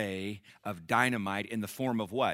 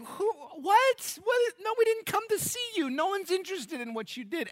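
much higher, mocking brush-off; dismissive crowd. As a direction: wave off the braggart, incredulous. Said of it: "Everybody be like".

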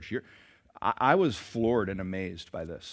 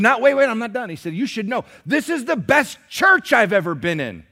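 high, loud, eager gushing; a fan who can't stop raving; excited gushing; 11 semitones higher, 11 dB louder than usual. Retold or imitated. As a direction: burst in with praise.

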